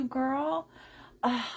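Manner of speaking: normal talking voice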